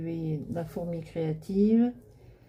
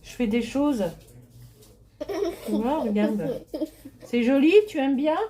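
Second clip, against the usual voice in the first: grandma voice; 7 semitones higher, 7 dB louder than usual. Real, not acted. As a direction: coo at a little granddaughter.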